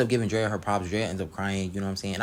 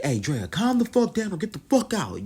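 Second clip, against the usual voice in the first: higher, louder imitated yell; mock yelling; 11 semitones higher, 4 dB louder than usual. Ricardo yelling at Drea, a mock higher yell. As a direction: bark it like an angry coach. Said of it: "Ricardo ends up yelling at Drea".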